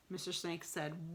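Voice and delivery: plain reading voice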